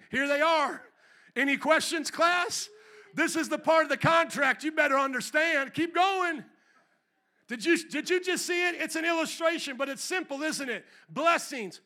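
loud, high fired-up sermon voice; preaching at full force. Acted. Preacher quizzing the congregation on the curses, loud and fired up. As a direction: shout it at the class like a stern teacher.

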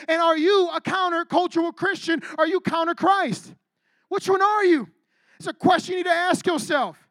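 fervent preacher's peak; preaching at full force. Acted. Climactic preaching.

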